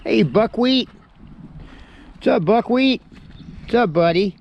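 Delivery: high loud sing-song to a goat; pet voice. Real, not acted.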